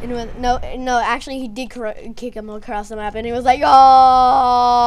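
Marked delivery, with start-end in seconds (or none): 3.6-4.8 loud, long mock wail; play-acted yell of a kicked figure; pretend flying scream. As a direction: belt out a drawn-out cry. Figure kicked across the map.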